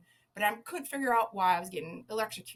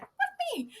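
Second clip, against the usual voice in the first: retold yell. Zookeeper recalling yelling at guests, high squeaked mock yell. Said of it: "I yell at the guests".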